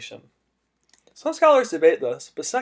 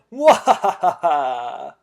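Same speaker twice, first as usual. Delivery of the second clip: evil laugh; 4 dB louder than usual. Pretend. Villain host laughs.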